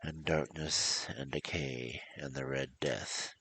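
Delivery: measured storybook reading voice; story narration. A narrator's reading. Narrator telling the fall of Prince Prospero, measured storytelling. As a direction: read it like a dark tale by candlelight. Reads a gothic tale aloud.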